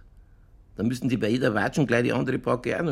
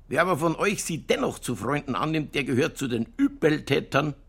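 solemn scripture reading; 5 semitones higher than usual. Acted. Innkeeper reads another Koran verse to his friend, declaiming. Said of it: "Jetzt pass mal auf".

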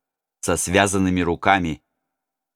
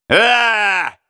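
sudden high yell from the crowd; robber shout; 12 semitones higher, 5 dB louder than usual. Acted. A robber in the crowd shouts back at the prince. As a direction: bark it out of the mob. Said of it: "закричал кто-то".

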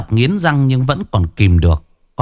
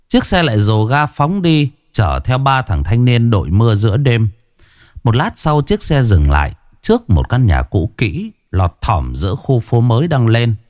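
usual speaking voice

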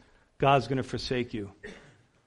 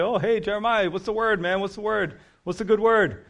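higher, teasing jeer at the prophet; mocking taunt; 6 semitones higher than usual. Mockers needling Jeremiah for a word, mocking. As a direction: toss it off like a smirking heckler. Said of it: "When they start mocking you".

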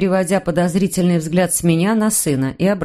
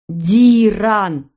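calling the name across the house, loud; booming shout; 4 dB louder than usual. A narrator's reading. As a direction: bellow it through the house. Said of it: "От громогласного крика".

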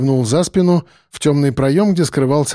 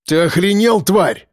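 higher-pitched, angry snarl; angry growl; 5 semitones higher than usual. A narrator's reading. Mordyukov rages at his captor, angry growl. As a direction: growl it out in anger. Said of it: "рыкнул Мордюков, стиснув решётку от злости".

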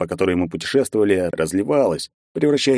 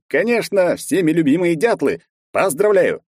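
mock cheerful; 9 semitones higher than usual. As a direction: announce it with gloating cheer. Mock-cheerful announcement to woodpeckers.

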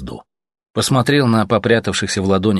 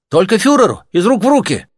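much higher and louder, shouted out; 13 semitones higher, 6 dB louder than usual. Acted. Artyom shouting his refusal at the officer. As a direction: fling the refusal out as a yell.

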